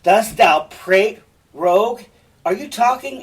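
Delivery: loud, high, incredulous demand; outraged stage questioning; angry challenge. Acted. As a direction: challenge him hotly, rising in pitch. Cassio challenges Rodrigo mid-fight.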